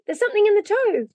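high, loud discovery; excited scream. A narrator's reading. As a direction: cry it out high and thrilled. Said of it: "screamed Davy".

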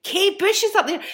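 high, loud re-enacted squeal of news; frantic excited cry. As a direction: shriek it to friends as if starstruck. Retells frantic news to friends.

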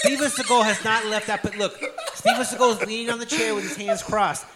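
high, loud, laughing commentary; laughing disbelief. Real, not acted. Host points out the unmoving actor to co-hosts, laughing incredulously.

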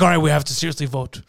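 low, grave put-on voice; serious gang leader. Leader calls a serious gang vote, grave.

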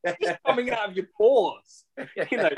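talking through a laugh; laughing out the punchline. Real, not acted. Laughing while telling friends about kimchi.

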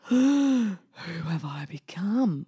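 slow, hushed dismay; horrified whisper. Retold or imitated. Mum realising she forces her daughter, hushed dismay. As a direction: whisper it in shock at yourself.

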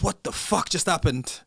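loud, slow disbelief; stunned outburst. Retold or imitated. Retells another's reaction to announcement.

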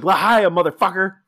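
loud, high hailing cry; mock triumphant shout. Host as Fink greeting Landfill before chugging, shouted. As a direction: belt it out as a boastful toast.

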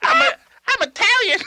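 very high, put-on Italian accent; Tony Zamboni. Tony answering about his nationality, high mock Italian accent. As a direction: squeak it out as a comic Italian. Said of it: "I can tell by the accent".

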